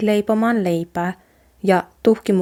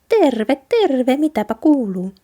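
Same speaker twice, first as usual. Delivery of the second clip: lightly raised, cheerful mouse greeting; little mouse voice; 6 semitones higher, 4 dB louder than usual. A narrator's reading. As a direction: voice a tiny friendly mouse. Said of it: "hiiri vastasi".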